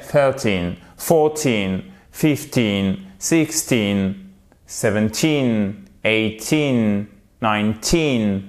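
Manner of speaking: put-on each number with a long falling "teen"; stress on TEEN. Shown as a demonstration. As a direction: lean on the last syllable from "Thirteen" to "nineteen". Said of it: "c'est la dernière syllabe, le teen, qui est accentué". (accent sur un « teen » long dans chaque nombre)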